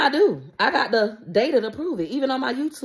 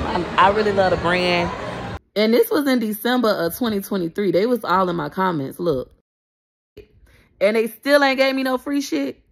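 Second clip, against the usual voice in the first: ordinary delivery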